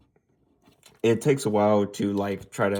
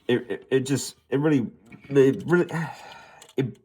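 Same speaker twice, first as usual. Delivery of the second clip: halting false starts ending in a sigh; stuttering; 2 semitones higher than usual. Real, not acted. Reviewer struggling to criticize a disappointing figure, stuttering. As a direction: stumble and restart, trailing into a sigh. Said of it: "I'm stuttering".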